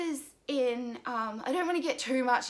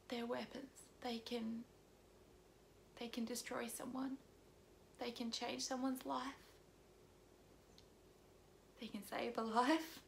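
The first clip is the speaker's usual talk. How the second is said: hushed and grave; 13 dB quieter than usual. Real, not acted. Warning viewers that words are weapons, hushed. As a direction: let it drop almost to a whisper. Tearful closing plea about words.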